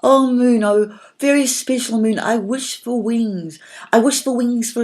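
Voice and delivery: loud, high call to the moon; calling out loudly. A narrator's reading. Little fairy calling to the moon, loud and high. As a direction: call up to the night sky as loud as you can. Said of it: "she called out loudly".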